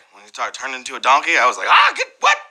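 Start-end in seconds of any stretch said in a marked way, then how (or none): 1.7-2.4 high-pitched startled yelps, played up; mock scared yelp. Host retelling a scary donkey scene, mock scared. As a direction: yelp as if freaked out, for laughs. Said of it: "That was scary".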